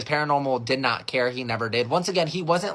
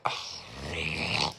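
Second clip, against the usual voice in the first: low, soft groan of dread; irritated groan; 10 semitones lower, 8 dB quieter than usual. Acted. Kid seeing Paranormal is the only option, irritated groan. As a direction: let out a weary, grudging ugh. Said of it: "in an irritated mood".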